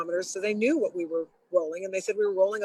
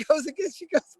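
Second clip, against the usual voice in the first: usual speaking voice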